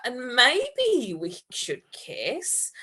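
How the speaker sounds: slow, drawn out, deliberate; slow suggestion. Acted. Andrew slowly proposing a kiss to Tom. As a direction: let each word hang.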